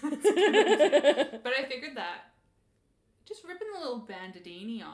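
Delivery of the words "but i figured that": laughing through the words, slow; giggling as she talks. Real, not acted. Podcast host to co-host, laughing.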